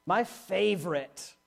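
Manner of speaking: slow, low, drawn-out sarcasm; sarcastic. Real, not acted. Preacher on hearing complaints all the time, sarcastic. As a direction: stretch it out dryly, dropping low. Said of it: "this is so sarcastic".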